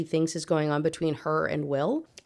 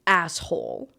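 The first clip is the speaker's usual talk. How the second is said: usual speaking voice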